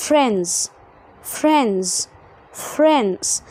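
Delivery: FRIENDS said lightly as "frenz"; silent D. Shown as a demonstration. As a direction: let the d fall out of "friends". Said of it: "تسقط الدي في نطقك". (نطق friends خفيف من غير دي)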